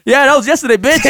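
ordinary delivery

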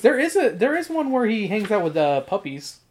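ordinary talk

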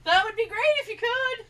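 sugary fake politeness; mock sweet request. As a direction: play the overly polite wife in jest.

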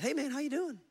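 high-pitched casual hello; imitated breezy greeting; friendly retold greeting. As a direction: call a light, high greeting to a stranger. Greets a stranger in story.

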